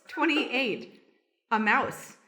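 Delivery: ordinary talk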